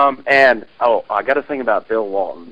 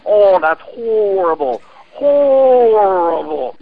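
mock-anguished commentator, much higher and slow; Bill Walton impression; 7 semitones higher, slower than usual. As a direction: wail that the pass is horrible, stretching every word. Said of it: "I have a Bill Walton imitation myself".

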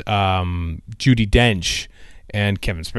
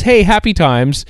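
excited; 9 semitones higher, 5 dB louder than usual. Real, not acted. Excited cheer about show returning.

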